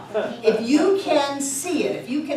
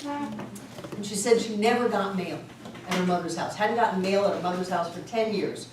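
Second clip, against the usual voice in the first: ordinary delivery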